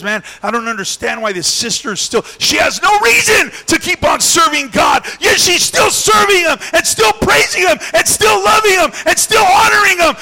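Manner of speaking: building from puzzled grumble to a loud, high furious shout; angry devil. Acted. Preacher voicing the frustrated devil to his congregation: building angry shout. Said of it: "He gets angry".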